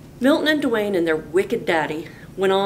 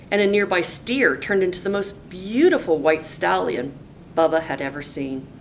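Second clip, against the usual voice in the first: usual speaking voice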